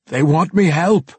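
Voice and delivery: loud, high-pitched agitated dwarf. A narrator's reading. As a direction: plead it out as a frightened dwarf. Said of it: "the dwarf explained".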